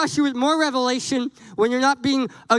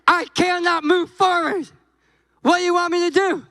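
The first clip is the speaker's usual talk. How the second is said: mock whiny plea; 7 semitones higher than usual. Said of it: "I'm gonna rephrase this".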